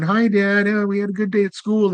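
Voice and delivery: put-on high, bright kid voices greeting dad; cheery kids. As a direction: chirp it like a happy child.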